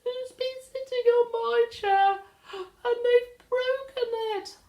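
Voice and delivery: very high, loud little bear voice; a tiny bear upset about his porridge; Baby Bear. Acted. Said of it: "and he said".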